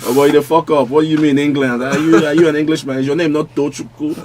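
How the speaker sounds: laughing, high mock Nigerian-brother jeer; teasing Nigerian brother. Mimicking Nigerian brothers mocking his England support, laughing. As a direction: ham up the scolding brother, laughing through it. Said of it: "start telling me".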